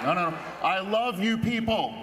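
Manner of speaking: ordinary talk, nothing marked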